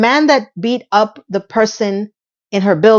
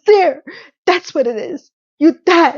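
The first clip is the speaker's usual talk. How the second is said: mock Charlotte; 7 semitones higher than usual. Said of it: "And she says".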